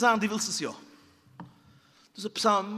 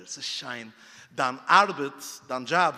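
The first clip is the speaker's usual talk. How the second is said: ordinary delivery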